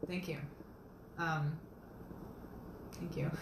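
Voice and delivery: soft, choked-up thanks; emotional. Real, not acted. Author moved by viewers' support, soft and emotional. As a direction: pause and quietly gather yourself while thanking them. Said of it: "Sorry to get a little emotional".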